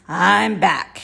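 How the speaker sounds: husky and loud, like a worn-out rat. A narrator's reading. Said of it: "he said in a husky voice".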